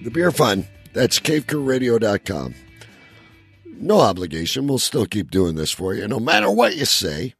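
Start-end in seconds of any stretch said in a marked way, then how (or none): none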